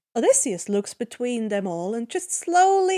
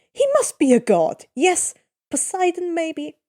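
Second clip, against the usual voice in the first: awed guess; 6 semitones higher, 5 dB louder than usual. Acted. Odysseus guessing Percy is a god.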